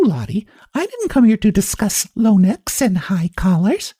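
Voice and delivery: a vain, teasing neighbour showing off; lively society lady. Acted. Mrs Wilson character voice.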